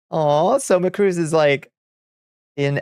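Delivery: high, drawn-out aww; adoring coo. Real, not acted. Streamer gushing over little Soma Cruz, adoring coo. Cooing over a young character.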